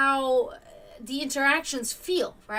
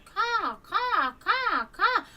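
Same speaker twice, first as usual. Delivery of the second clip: high-pitched mimicked hawk cry, caw caw; imitated hawk caws; 11 semitones higher, 4 dB louder, much slower than usual. Imitating the hawk for viewers, high squawking caws. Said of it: "and then again".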